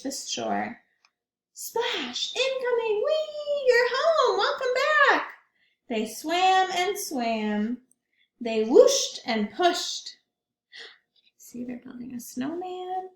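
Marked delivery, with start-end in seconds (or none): 1.5-5.3 much higher gleeful shouts; excited calls. Acted. Penguins greeting in water. 11.4-13.1 soft pointing aside; whisper. Real, not acted. Aside to children.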